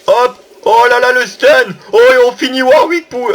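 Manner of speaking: excited gamer yelling at the fight; excited outburst. Real, not acted. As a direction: cheer the stun as it lands.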